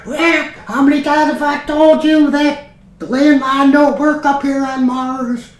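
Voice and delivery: high, loud mother yelling from another room; nagging mother. Acted. Mother scolds Rick about the landline, high hammed-up yell. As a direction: holler like a fed-up mum in the background. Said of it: "you can hear his mother in the background".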